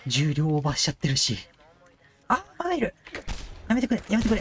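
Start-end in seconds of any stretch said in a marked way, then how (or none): 2.3-4.4 panicked pleading. Real, not acted.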